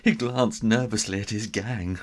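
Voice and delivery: plain reading voice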